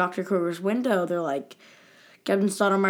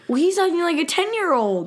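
put-on higher, louder childish comeback; mock childish retort; 9 semitones higher, 3 dB louder, faster than usual. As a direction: fire back like a petty kid. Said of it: "Harold Crenshaw versus Adrian Monk".

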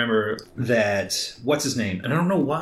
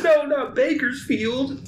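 mock lament; 11 semitones higher, slower than usual. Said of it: "They're just like".

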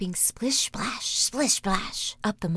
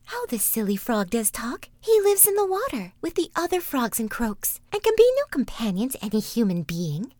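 princess voice; 3 semitones higher, 4 dB louder than usual. Acted. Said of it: "She, however, thought".

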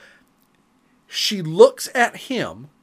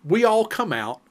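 ordinary delivery

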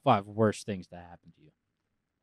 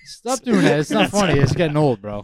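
mock scolding; 4 semitones higher, 9 dB louder than usual.

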